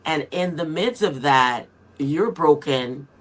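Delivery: usual speaking voice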